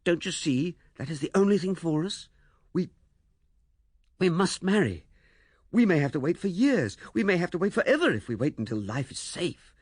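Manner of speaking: impassioned and determined; strong, resolute. A narrator's reading. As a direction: plead with rising conviction. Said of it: "flood of his resolution suddenly strong and steady".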